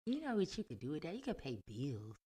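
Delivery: lightly voiced [name] B impression. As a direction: slip into a playful [name]-style delivery. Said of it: "got your whole [name] voice".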